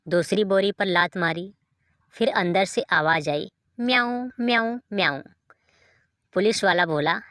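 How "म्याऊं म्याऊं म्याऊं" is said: higher, meowing like a cat; playful imitated meowing; mock cat meows. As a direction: meow like a cat caught in a sack. Cat meowing from inside sack.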